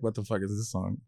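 low, soft, bewildered sneer; baffled disdain. Retold or imitated. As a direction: ask it in flat disbelief. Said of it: "they're all looking at like".

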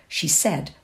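usual speaking voice

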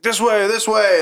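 high-pitched urgent calls; urgent townsfolk. Acted. Townspeople send body to Lord Mayor, urgent. Townspeople usher the party through.

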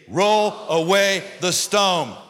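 loud, drawn-out commanding cry; booming, slow congregational declaration; reading like you mean it. Shown as a demonstration. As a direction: proclaim it slowly at full voice, word by word. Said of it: "read these like you mean it".

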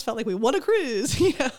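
loud, breaking into laughter; giddy laughing disbelief. Retold or imitated. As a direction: laugh out the punchline in delight. Laughing retelling of cruise gift.

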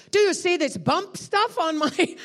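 high, loud, wondering outburst; excited cry in halting English; imitated astonished man. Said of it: "that's how he said it".

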